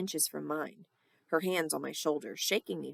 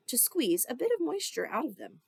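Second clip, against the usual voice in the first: plain reading voice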